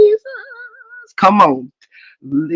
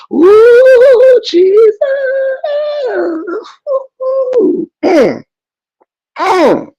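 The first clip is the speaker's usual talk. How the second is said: real high outcry; high woo Jesus; much slower than usual. Real, not acted. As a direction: cry out high, overcome. Overcome exclaims with cry.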